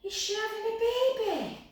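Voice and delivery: lightly voiced curious child; imitating a little boy. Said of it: "And then he said".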